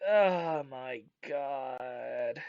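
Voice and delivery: long, low, soft drawn-out groan; exasperated groan. Real, not acted. Fan despairing at the season's only reason for existing, exasperated groan. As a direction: drag it out slowly in weary exasperation.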